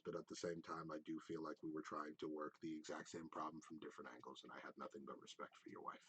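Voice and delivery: low, soft, sincere sympathy; respectful condolence. Acted. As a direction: offer condolences gently and quietly. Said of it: "He's like".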